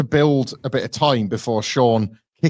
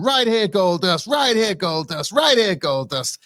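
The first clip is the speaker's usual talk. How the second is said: high, drawn-out fan chant; mock excited ringside heckling; imitating an excited heckler; 7 semitones higher than usual. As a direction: call it out high, stretching each repeat. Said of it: "he's really excited".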